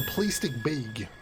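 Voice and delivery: Chicago-accented, with "plastic bag" vowels pulled toward "playstic beg". Shown as a demonstration. Streamer demonstrating how Chicagoans say it, Chicago accent. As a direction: say it like a Chicago local. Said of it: "Unless you're in Chicago".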